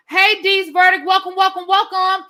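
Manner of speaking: bright, loud, high welcome to a viewer; cheerful shout-out greeting a viewer by name. Real, not acted. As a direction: call out a big welcome.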